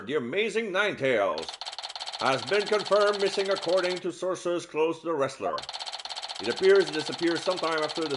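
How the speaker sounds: newscaster read, brisk; news anchor. Acted. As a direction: deliver it like TV news. Reads in-game news report.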